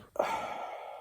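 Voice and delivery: weary sigh. Real, not acted. Exhausted sick sigh.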